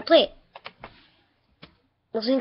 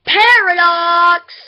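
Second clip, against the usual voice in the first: dramatic announcement; 5 semitones higher, 8 dB louder, much slower than usual. Acted. Dramatic title call.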